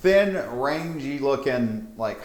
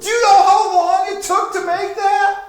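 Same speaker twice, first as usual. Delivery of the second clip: very high, loud, frantic screeching; shrieking panicked necromancer; 9 dB louder than usual. Acted. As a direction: shriek in total disbelief at the loss. Necromancer scolds the party.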